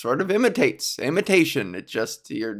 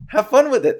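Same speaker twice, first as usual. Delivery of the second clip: high, louder catchphrase; catchphrase again, higher; 9 semitones higher, 5 dB louder, faster than usual. Shown as a demonstration. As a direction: punch it out like a trademark slogan. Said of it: "That's what I always say".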